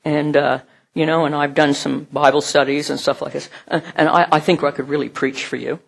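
mock self-assured applicant; a hint of a boastful candidate; cocky applicant. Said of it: "somebody comes up to me and says".

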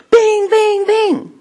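sing-song high bings like a flasher; high beacon bings. Retold or imitated. Preacher showing his light at the office, high bings. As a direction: chime them out like a little light. Greeting the neighbor, beaming light.

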